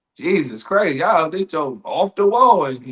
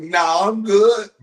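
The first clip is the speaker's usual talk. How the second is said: high, loud, drawn-out brush-off; slow, raised voice, declining the pass; acted refusal; 5 semitones higher, 4 dB louder, much slower than usual. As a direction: stretch out a comic no-thanks. Acted receiver declines the ball.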